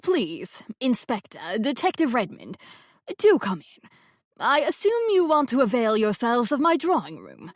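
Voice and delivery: a hint of a gracious elderly hostess; genteel old woman welcoming the police; lightly voiced grand old lady. A narrator's reading. Elderly landlady Rose voiced.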